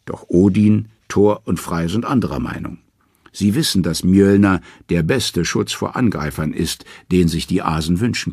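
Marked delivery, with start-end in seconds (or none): none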